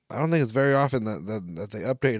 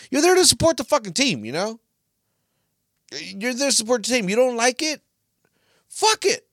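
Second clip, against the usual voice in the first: heated rant; 10 semitones higher than usual. Real, not acted. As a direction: let the frustration boil over. Ranting at the Ultras.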